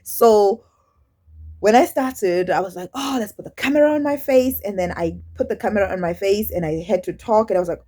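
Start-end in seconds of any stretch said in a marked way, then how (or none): 2.9-4.6 eager self-quote. Retold or imitated. Retelling her eager first attempt.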